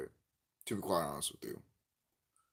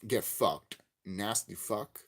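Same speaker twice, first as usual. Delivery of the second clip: ordinary talk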